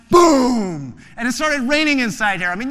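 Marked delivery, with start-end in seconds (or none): 0.1-1.0 thunder boom. Acted. Imitating thunder.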